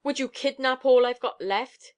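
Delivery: high, loud savage snarl; fierce mother guarding her child. A narrator's reading. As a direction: hurl it back over the shoulder. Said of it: "she said savagely over her shoulder".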